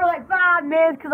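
normal talking voice

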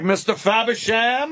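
high, slow stagey query; mock stagey actor. Parodying someone acting, high stagey delivery. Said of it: "if you're acting, it would be like".

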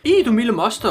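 high-pitched, mildly acted exclamation; storybook cry of alarm. A narrator's reading. Hippo lady startled by the steamroller, high acted cry. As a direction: cry out in high, hammed-up dismay. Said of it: "råbte fru Flodhest".

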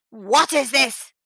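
loud, high, slow indignation; outraged demand. A narrator's reading. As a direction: demand it slowly and loudly. Tyrant angered by braying donkey.

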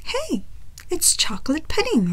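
usual speaking voice

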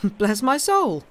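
high, loud and slow astonished exclamation; exclaimed. A narrator's reading. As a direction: cry it out, drawn-out and amazed. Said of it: "Debray exclaimed".

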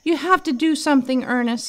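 high, anxious plea; begging, afraid for his friend; fearful. A narrator's reading. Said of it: "with a fearful look in his eye".

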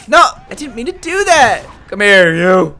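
high, loud protest; exasperated gamer shout; frustrated yelling. Real, not acted. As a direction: yell at your own slip, then go after him. Game mistake, chasing enemy.

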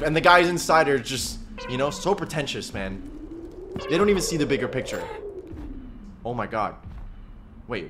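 ordinary delivery

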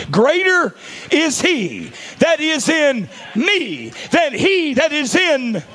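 high, loud, slow declaration; preaching shout. Acted. Preacher declaring scripture against hell, high slow shout. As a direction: proclaim it slowly at the top of the voice.

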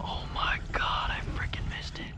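soft whisper of disbelief; real whispered dismay. Real, not acted. Kayak angler missing a strike, whispered dismay. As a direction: breathe it out, crushed. Muttering after losing the fish.

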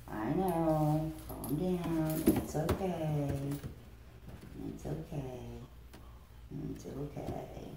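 gentle shushing fading to whisper. Real, not acted. Calming frightened monkey before shot.

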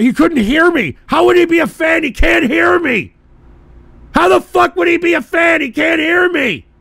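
loud, very high, incredulous yelling; exasperated high-pitched rant; outraged shout. Real, not acted. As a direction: shout the question in disbelief, full voice. Outraged rant, repeated louder.

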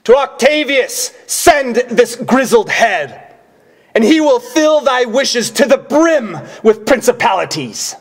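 high, loud proclamation; ringing stage shout, pitched high. Acted. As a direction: hurl it out to the back of the room. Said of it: "when you are shouting, shout at me".

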